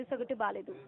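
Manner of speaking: ordinary talk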